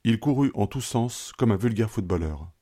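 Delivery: usual speaking voice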